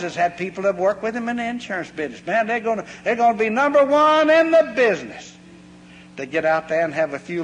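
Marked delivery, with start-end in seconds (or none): 2.3-5.3 high, loud mock-eager boast; a hammed-up brag about being top salesman. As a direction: puff it up like a cocky new salesman. Mocking boastful new salesmen.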